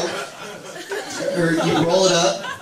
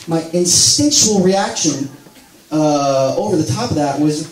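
normal talking voice

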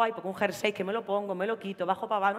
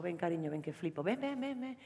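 soft coaxing; 3 semitones lower, 10 dB quieter than usual. Retold or imitated. Lolo coaxing her to come.

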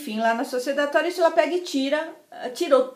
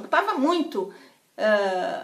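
ordinary delivery, nothing marked

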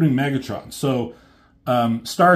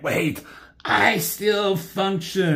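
weak Megatron; 8 semitones higher, slower than usual. Retold or imitated. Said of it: "Megatron's like".

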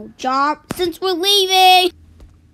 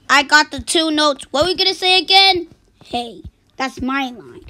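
normal talking voice

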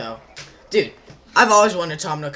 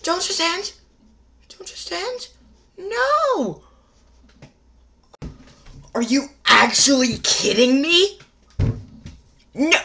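very high, loud shocked screams; screaming; 13 semitones higher, 11 dB louder, slower than usual. Real, not acted. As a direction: shriek at the twist. Screaming at a surprise reveal.

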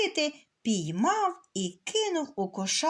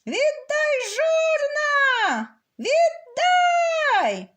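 very high, loud rooster scream; screaming at the top of his lungs; 13 semitones higher, 5 dB louder, much slower than usual. Acted. Rooster out of the sheep pen, screaming at the master. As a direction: scream it with all your throat. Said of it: "кричить на всю горлянку".

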